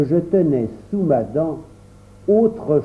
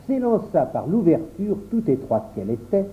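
plain reading voice

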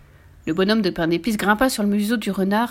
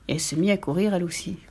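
ordinary delivery

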